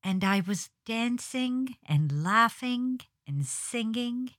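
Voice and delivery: recited line, "dancing and laughing and singing" each ending in a hard g; hard G's. Shown as a demonstration. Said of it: "I had one specific line that went".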